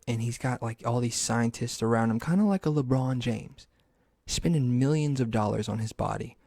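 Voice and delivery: usual speaking voice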